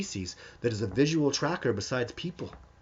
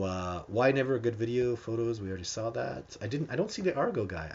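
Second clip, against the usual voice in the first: ordinary talk, nothing marked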